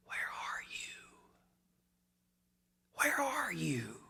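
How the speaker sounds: hushed, breathy whisper; quiet whisper. Acted. Preacher voicing God's gentle question, whispered. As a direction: whisper it like a still small voice. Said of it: "that little quiet whisper saying".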